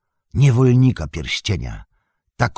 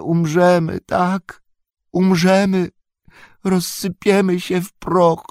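slow, high creature whine on "okrutnym żelazem"; whining Gollum; 11 semitones higher than usual. Acted. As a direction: plead like a pitiful wretched creature. Gollum pleads for his life.

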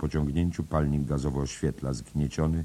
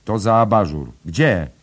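loud, higher call; 6 semitones higher, 11 dB louder than usual. A narrator's reading. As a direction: toss the coin and shout. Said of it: "woła".

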